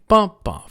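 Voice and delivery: BUMP bump, strong high first beat then a weak one; first-beat stress. Shown as a demonstration. Teacher beats out the word rhythm, stressing the first bump. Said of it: "first syllable".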